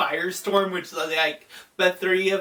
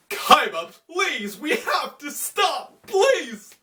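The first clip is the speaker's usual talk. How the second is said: high-pitched mock pleading; Yugi; 8 semitones higher, slower than usual. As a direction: plead urgently in a hammed-up high voice. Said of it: "And Yugi's still like".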